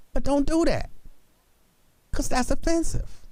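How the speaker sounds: high-pitched admonishment; mock scolding. Host cutting off someone telling a story, mock scolding.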